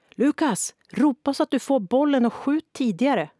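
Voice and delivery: high, loud yelling at her son; sideline yell. A narrator's reading. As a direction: scream orders from the touchline. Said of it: "som skrek från sidlinjen".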